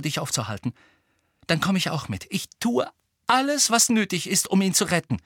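earnest, pleading appeal; desperate plea. A narrator's reading. Hermine promising Harry her help, pleading desperately. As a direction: beg him to check first. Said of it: "sagte Hermine verzweifelt".

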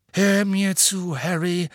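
slow, emphatic appeal, pitched high. A narrator's reading. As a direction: insist that the pupil listens, drawing it out. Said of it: "sagte er nachdrücklich".

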